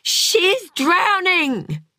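high, drawn-out scream of alarm; screamed warning. A narrator's reading. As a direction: scream it slowly to get help. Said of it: "screamed Harry".

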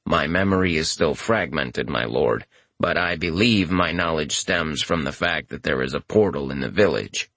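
low, level and emotionless; deadpan familiar voice. A narrator's reading. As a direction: speak without any feeling, deep and even. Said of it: "Futon's deadpan voice".

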